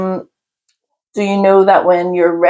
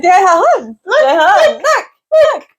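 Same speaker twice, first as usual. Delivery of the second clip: excited high squeal. Retold or imitated. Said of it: "and go like".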